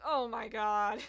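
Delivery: retelling her own stunned reaction; disbelieving exclaim. Retold or imitated. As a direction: blurt out a stunned oh my god. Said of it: "I probably went like".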